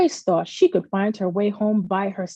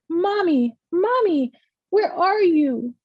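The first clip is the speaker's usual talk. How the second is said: high calling out for mommy; a lost child calling out; frightened calling; 8 semitones higher, slower than usual. A narrator's reading. Lost girl calls her mother.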